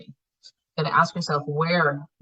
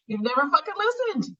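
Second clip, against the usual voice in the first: words burst out high through a laugh; 10 semitones higher than usual. Real, not acted. Coach laughing at listeners who never listen. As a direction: laugh while scolding, pitch leaping up.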